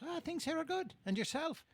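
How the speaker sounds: high, chirpy Irish brogue; Irish leprechaun. Acted. Leprechaun making small talk with golfer, high Irish voice. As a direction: answer high in an Irish lilt. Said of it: "the leprechaun pops out".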